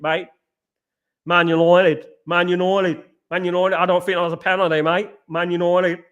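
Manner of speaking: mock Australian accent; laid-back Aussie football manager, every line tagged "mate"; Ange impression. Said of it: "And Ange will be".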